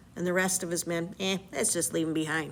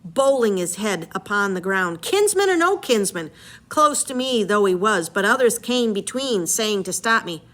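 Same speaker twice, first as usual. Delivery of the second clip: story narration, read aloud with energy; dramatic reading; 5 dB louder than usual. Acted. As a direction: read the epic aloud to the class. Teacher reads Odyssey aloud.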